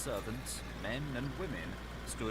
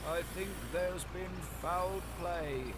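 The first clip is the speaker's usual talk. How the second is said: slow, hoarse and higher, a frightened servant; 9 semitones higher, slower than usual. A narrator's reading. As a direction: rasp it out slowly, afraid to say it. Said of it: "said Poole hoarsely".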